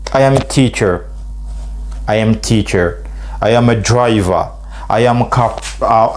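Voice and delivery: ordinary delivery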